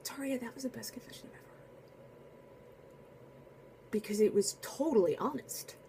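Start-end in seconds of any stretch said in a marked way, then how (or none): 0.0-1.7 soft, quick whisper; gentle whisper. Retold or imitated. Priest whispers to the sobbing penitent, soft and quick. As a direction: whisper it tenderly, quick and hushed. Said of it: "And he goes".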